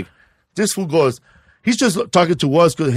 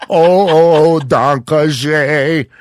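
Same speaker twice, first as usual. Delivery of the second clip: slow, louder hammed-up German "danke schön"; drawn-out German thanks; 5 dB louder, much slower than usual. Shown as a demonstration. To the ladies at the bar, a slow crooned German "danke schön". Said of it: "sing German to the ladies".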